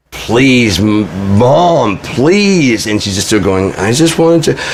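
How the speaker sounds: pleading, drawn-out pitch swooping high; begging. Retold or imitated. Adult child begging mom for the phone, pleading. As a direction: plead with long, rising and falling words. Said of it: "I'm begging".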